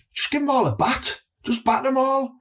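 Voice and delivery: high-pitched, hammed-up Middlesbrough accent; mock threat in broad Middlesbrough; worst Borough accent. Shown as a demonstration. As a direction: lay the Boro accent on thick, high and aggressive. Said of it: "put your worst borough accent on".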